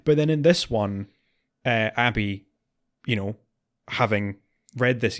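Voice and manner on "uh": ordinary talk, nothing marked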